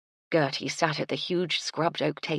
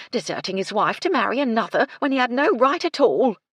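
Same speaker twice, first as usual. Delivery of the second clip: indignant housekeeper; 5 semitones higher, 7 dB louder than usual. Acted. Housekeeper condemns the lad who deserted his wife, indignant. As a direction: voice climbing louder and higher in indignation.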